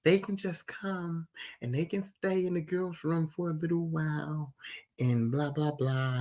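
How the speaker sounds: soft imitation of mom being sweet; nice and kind. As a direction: coax him gently, very softly. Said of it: "all nice and kind".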